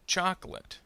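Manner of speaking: "Chocolate." said as CHOK-lut; dropped middle syllable. Shown as a demonstration. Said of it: "middle syllable will disappear".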